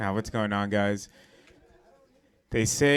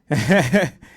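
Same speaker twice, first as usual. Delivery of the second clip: loud, wavering burst of laughter; laughing; 5 semitones higher, 9 dB louder than usual. Real, not acted. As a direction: crack up at your own joke. Comic laughs at his own bit.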